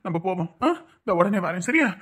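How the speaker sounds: steady a panicking friend; calming. Acted. Elder tells grieving caller not to panic, calming.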